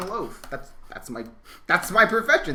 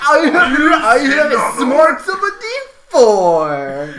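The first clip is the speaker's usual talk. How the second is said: shouted, much higher and louder dim-witted brute; dim oaf voice; 14 dB louder, much slower than usual. Acted. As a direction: bellow it like a proud, dumb oaf. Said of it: "what my character would say".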